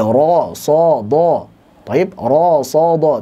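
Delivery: "ro, so, do" with the jaw dropped and the lips slack, not pushed out; like someone gaping blankly; a dazed open O on "ro, so, do". Shown as a demonstration. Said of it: "tidak mantul".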